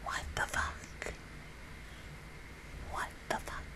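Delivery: stunned whisper. Real, not acted. Whispering at the screen in stunned disbelief. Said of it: "I don't even know why I'm whispering".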